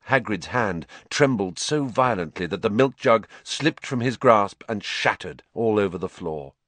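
usual speaking voice

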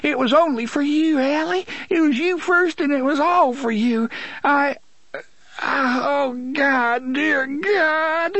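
high, sobbing plea that breaks into crying; broken man crying out to Ali and to God. Acted. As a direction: let the words dissolve into tears, high and strained. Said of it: "Sheb sobbed".

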